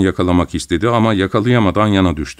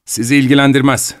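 louder and higher, cold anger; cold and angry; 5 semitones higher, 4 dB louder than usual. A narrator's reading. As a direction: shut him out with a cold, angry line. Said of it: "soğuk, öfkeli bir tavırla". (soğuk ve öfkeli)

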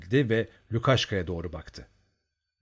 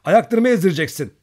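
higher, louder indignant cry; flustered shout; 6 semitones higher, 8 dB louder than usual. A narrator's reading. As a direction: snap at him, embarrassed. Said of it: "bağırdı". (utanıp kızarak yüksek sesle bağırıyor)

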